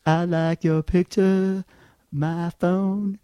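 slow, loud, dopey mock voice; mocking the caller. Comedian mimics the caller's voicemail, slow and loud. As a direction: drag it out like a slow simple guy. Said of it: "so I'm listening to this going".

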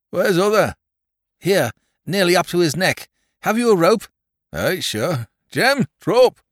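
loud country yokel voice; rustic farmer calling. Acted. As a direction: call like a farmhand. Rescuer and Bunter calling.

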